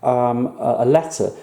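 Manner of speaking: usual speaking voice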